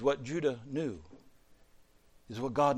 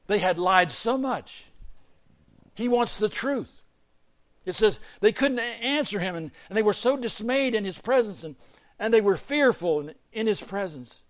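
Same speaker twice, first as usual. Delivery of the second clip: loud, high, intense delivery; full-force preaching; 9 semitones higher, 8 dB louder than usual. Real, not acted. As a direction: drive it home to the congregation.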